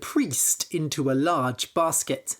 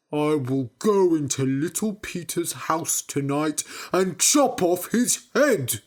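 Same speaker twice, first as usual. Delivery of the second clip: mean every word; menacing threat. Acted. Big Peter angry, plotting.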